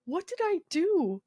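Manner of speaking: high, plaintive question; retold helpless confusion; bewildered plea. Patient asking the tearful nurse, bewildered.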